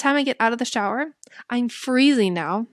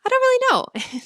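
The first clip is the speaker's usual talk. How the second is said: high, unsure imitation of the nurse; lightly voiced nurse at a much higher pitch; 4 dB louder than usual. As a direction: mimic her clueless shrug of a reply. Retelling nurse's unsure reply.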